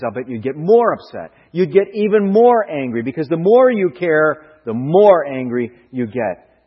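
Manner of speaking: rising preaching. Acted. Preacher to congregation, building to a loud climax. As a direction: drive it home with rising force.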